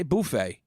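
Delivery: buffet with a long "boo" first syllable; boo-FAY pronunciation. Shown as a demonstration. Host countering with his own version, buffet as "boo-FAY". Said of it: "I say buffet".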